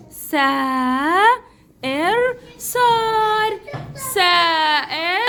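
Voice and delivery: very slow "سائر" in held pieces, "صار" blended long; syllable sounding-out. Shown as a demonstration. Teacher showing the class a written word, slowly sounding out "سائر صار". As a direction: stretch each part of "سائر", then draw out "صار".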